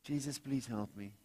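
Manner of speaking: sleepy quiet plea. Shown as a demonstration. Preacher mocking a tired prayer to Jesus, soft sleepy plea. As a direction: mumble it like you're half asleep. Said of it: "I don't say".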